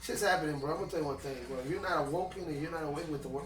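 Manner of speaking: normal talking voice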